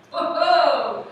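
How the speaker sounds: loud, high "whoa" of alarm; startled cry. Acted. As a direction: blurt it like life just lurched, acted. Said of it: "and I'm like".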